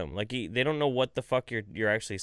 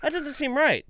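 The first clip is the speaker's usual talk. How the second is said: put-on much higher, louder kid voice; a skeptical little kid; doubting kid; 13 semitones higher, 5 dB louder than usual. Calvin doubting the advice, high kid voice.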